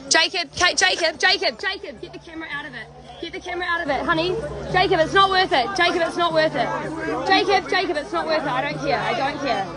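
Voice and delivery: high, anxious calls to Jacob to back off; urgent pleading. Real, not acted. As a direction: pull your partner away from trouble, urgently. Stopping partner filming angry protesters.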